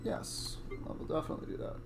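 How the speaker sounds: fast quick whisper. Real, not acted. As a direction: rush it out under your breath. Quick whispered aside to friend.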